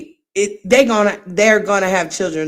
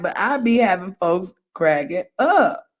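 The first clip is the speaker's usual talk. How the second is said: ordinary talk, nothing marked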